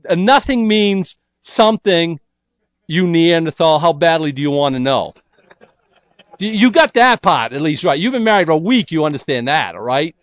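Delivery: loud, high exasperated scolding of a clueless husband; mock scolding wife. Wife scolding her clueless new husband, loud and exasperated.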